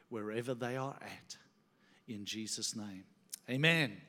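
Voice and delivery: low, soft, earnest prayer; leading prayer. Real, not acted. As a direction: pray aloud for the congregation, unhurried and hushed. Opens the sermon with prayer.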